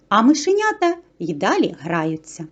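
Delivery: usual speaking voice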